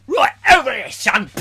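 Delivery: high, loud barked order; hooded intruder. Retold or imitated. Raiders kick in Pete's door, loud hammed-up shout.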